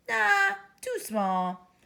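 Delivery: high, very slow dismissive nah. Acted. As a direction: reject the needle with a sneer. Doctor rejects needle.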